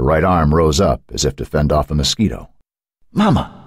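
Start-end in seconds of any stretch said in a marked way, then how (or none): 3.1-3.5 whip-crack call. A narrator's reading. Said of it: "the voice cracked like a whip".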